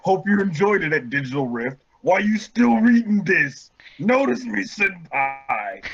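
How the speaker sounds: mock-cutesy author voice, swooping high; over-eager fangirl plea; gushing fanfic author. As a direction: play the needy fan writer, hammed up. Reads author's notes in role.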